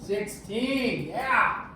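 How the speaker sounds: celebrating getting it right; excited. Real, not acted. Student gets answer right.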